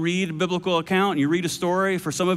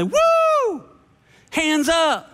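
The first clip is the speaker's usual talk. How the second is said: high whooping shout; high mock shout of praise; mock worship cheer; 10 semitones higher, much slower than usual. Mocking worship crowd cheer.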